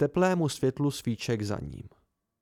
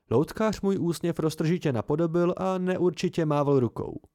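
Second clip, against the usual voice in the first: usual speaking voice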